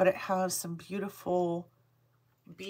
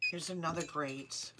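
ordinary talk

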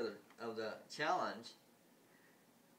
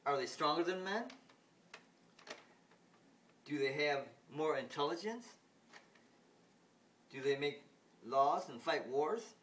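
higher and louder, slowing at the end; mocking questions; 3 semitones higher, 5 dB louder than usual. Ruler taunts the mother, high and loud. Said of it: "Then the ruler goes".

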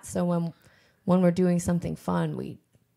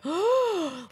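high shocked gasp; mock gasp; 10 semitones higher than usual. Shown as a demonstration. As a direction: gasp like an appalled audience. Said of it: "like, gasps".

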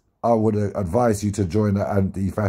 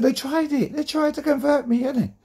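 high mocking retort. Retold own words, far voice.

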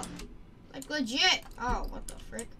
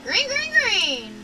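race start call; 8 semitones higher, 7 dB louder, slower than usual. Pretend.

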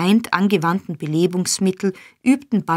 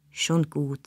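much softer resigned sigh; 3 semitones lower, 7 dB quieter than usual. A narrator's reading. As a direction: give in with a tired breath. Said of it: "sagte der Jude mit einem Seufzer".

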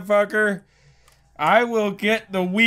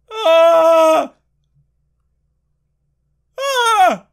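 high, loud drawn-out yelp; wordless high cry of dread; frightened wail; 10 semitones higher, 8 dB louder than usual. Real, not acted. Player dreading the handshake, high frightened wail. Screams at the creepy encounter.

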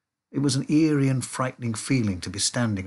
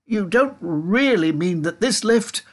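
high, slow disbelief; pitched up, drawing out the words; alarmed shout; 8 semitones higher, 4 dB louder than usual. A narrator's reading. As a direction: protest in dawning alarm. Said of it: "shouted Grandpa Joe".

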